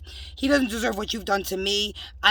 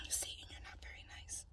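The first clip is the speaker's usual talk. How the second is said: whisper; 22 dB quieter than usual. Real, not acted. Whispered scolding of Satan.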